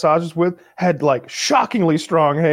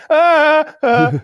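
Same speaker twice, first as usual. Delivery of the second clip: sharp high pained cry; 13 semitones higher, 5 dB louder than usual. Retold or imitated. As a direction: cry out in sudden pain. Recalling excruciating back pain.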